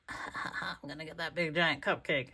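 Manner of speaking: a soft, scheming little raccoon voice; sly raccoon voice. Acted. Raccoon plotting to steal the big cupcake, sly and soft. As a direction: plot it quietly like a greedy critter. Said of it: "the little trash panda raccoon is like".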